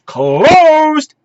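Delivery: high, drawn-out punch on the word; hammed-up closing announcement; mock announcer holler. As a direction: belt it out as a punchline.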